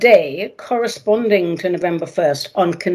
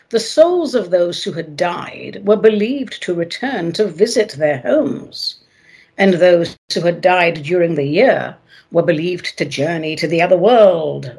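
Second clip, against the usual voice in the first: normal talking voice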